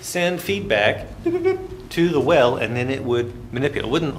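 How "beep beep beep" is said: high imitated electronic beeps; satellite beeps. Lecturer mimicking a wellhead signal, high beeps. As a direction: beep like a data transmitter.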